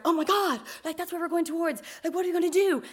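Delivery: retold fretting to a partner; a touch of high-voiced alarm. Retells own alarm at the warning.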